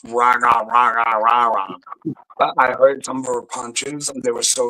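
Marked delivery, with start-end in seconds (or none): 0.0-1.8 raspy muffled. Retold or imitated. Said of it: "She has a raspy voice".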